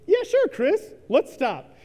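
high, loud, fake-cheerful yes; forced cheerful agreement. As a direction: chirp agreement while hiding panic. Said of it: "what came out of my mouth was".

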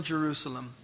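a hint of Jesus weeping over the city; weeping lament. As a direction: let Jerusalem sink into a soft, grieving sigh. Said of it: "he's weeping".

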